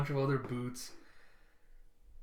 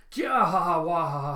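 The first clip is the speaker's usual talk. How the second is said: loud cackling "kya ha ha"; acted rowdy revellers' laugh; party laughter; 3 semitones higher, 8 dB louder than usual. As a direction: cackle high, dropping to wah-ha-ha.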